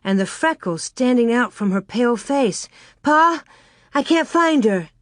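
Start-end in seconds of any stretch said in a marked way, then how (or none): 3.0-4.9 high, anxious cry for Pa; frightened little girl reporting; child alarmed, voice raised; scared. A narrator's reading. Her eyes large and scared.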